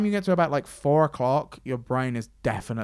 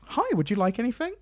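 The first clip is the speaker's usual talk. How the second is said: high, sing-song helpful offer; imitating eager helper; 8 semitones higher than usual. Volunteer offering the host a coffee, high eager imitating voice. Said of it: "been like".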